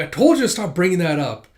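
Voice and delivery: annoyed snap. Acted. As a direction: tell her to drop the subject, loud and irritated. Embarrassed brother snapping back.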